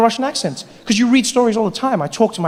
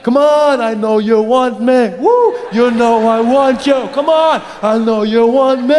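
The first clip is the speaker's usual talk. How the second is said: slow, drawn-out leering come-on; hammed-up pushy creep, dragging the words out; creepy guy; 3 semitones higher, 4 dB louder, slower than usual. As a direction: badger them with sleazy persistence. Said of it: "That creepy guy comes up".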